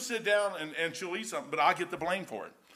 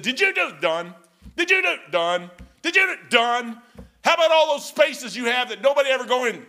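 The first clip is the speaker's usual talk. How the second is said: higher, louder, badgering questions; mock inspector shouting; 5 semitones higher, 9 dB louder than usual. Inspectors grilling the sailor, loud and badgering. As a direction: hammer him with the same question.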